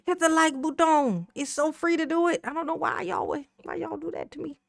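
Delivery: high, soft whimpering fake sob; badly wounded act, trailing off very softly; mock crying. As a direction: plead as if hurt, fading into a tiny mock whine. Mock crying plea for likes.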